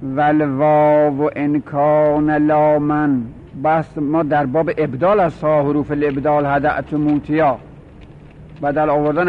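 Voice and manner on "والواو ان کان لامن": slow, drawn-out recital of a classical verse; verse recitation. Acted. Teacher reciting the rule's verse to the class, slow and chanted. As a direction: intone the line of grammar verse slowly.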